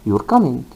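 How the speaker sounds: "You're coming" with a falling tune, as a fact; statement intonation. Shown as a demonstration. Teacher showing learners a statement, falling intonation. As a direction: let the pitch drop on "coming", no question in it. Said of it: "not a question".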